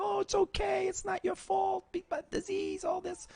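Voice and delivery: high, hushed mock consoling voice; imitating a sympathetic friend in falsetto; soothing falsetto. Mocking how people console addicts, falsetto. Mimicking someone consoling him.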